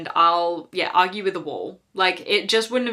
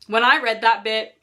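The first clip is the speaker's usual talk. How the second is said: usual speaking voice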